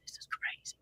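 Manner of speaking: hushed, voiceless disbelief; amazed whisper. Retold or imitated. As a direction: whisper it in astonishment. Retells amazed reaction to old posts.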